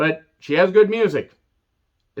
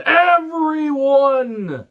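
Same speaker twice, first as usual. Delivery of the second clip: drawn-out scream; 11 semitones higher, 4 dB louder, much slower than usual. Retold or imitated. Movie villain quote screamed.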